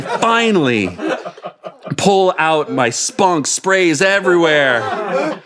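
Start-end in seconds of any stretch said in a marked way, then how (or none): none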